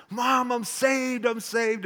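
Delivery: high, loud, slow joyful shout; crying with joy. Retold or imitated. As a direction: burst out to mom, overjoyed and tearful. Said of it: "I was still crying and just rejoicing".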